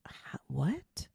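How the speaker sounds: 轻声惊呆地低语; 不敢相信，几乎只有气声; stunned whisper. Retold or imitated. Stunned by cop's revelation.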